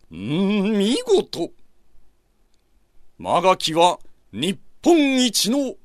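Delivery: high, slow, grand praise; proud lord's praise. Acted. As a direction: praise him grandly like a shogun. Shogun praises the rider.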